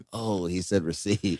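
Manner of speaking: low, slow, very soft aside; quiet realization. Acted. Customer realizing it later to himself, quiet aside. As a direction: say it under your breath, slowly. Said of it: "I would have been like".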